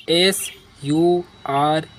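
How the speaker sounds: letter by letter, spaced out; dictating letters to a class; slow spelling. Shown as a demonstration. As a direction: spell each letter slowly. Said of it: "spelling the letters out".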